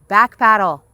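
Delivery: loud, sharp command shouted across the river; urgent yell. Acted. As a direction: bark the order to the paddler. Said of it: "as Dad yells".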